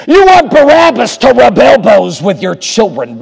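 high, loud pulpit tirade building to a shout; full-force indignant preacher, crying out; outraged preaching. Real, not acted. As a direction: challenge them in rising disbelief. Outraged preacher challenges the crowd's choice.

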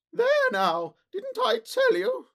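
very high, slow wail of panic; frantic cry. A narrator's reading. Sholto arrested, cries out in high panic. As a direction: cry out in helpless alarm. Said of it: "cried the poor little man".

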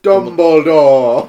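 loud, slow, laughing reveal; loud laughing reveal of the figure; dramatic reveal. Acted. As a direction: announce the twist with a laugh.

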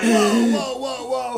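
backing off with hands up at gunpoint; panicked whoa. Retold or imitated. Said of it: "And I'm like".

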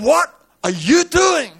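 very high, loud, drawn-out question; slow high-pitched shouted question; acted outcry. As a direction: cry it out in alarm. Acted question of startled companion.

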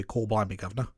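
stock Cockney practice line; mock Cockney accent. Shown as a demonstration. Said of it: "line you would use to practice that".

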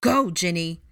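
loud, urgent order; commanding. A narrator's reading. Arthur sends his daughter out of danger, commanding. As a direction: order her away with force. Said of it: "Arthur commanded".

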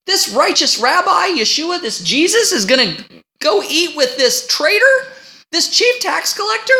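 voicing a scandalized crowd, pitch climbing; indignant disbelief. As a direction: sound outraged that the rabbi eats with a traitor. Voicing shocked onlookers.

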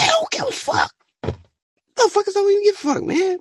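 high outraged cry. Real, not acted. Outraged at the government.

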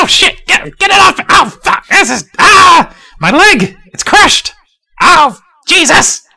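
high, loud, crying out in pain; panicked screaming. Acted. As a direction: scream that your leg is crushed. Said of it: "he's like".

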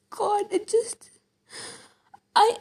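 slow, tearful, voice catching; crying. Real, not acted. Vlogger recalling the film scene, crying and slow. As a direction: let the tears break through. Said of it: "I just got so emotional".

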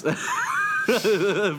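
a sudden high burst of laughter; loud laugh. Real, not acted. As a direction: break into a big loud laugh. Reacting to Jess's face.

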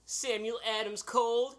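high showy product announcement; announcer voice. Acted. Announcing beer name, high.

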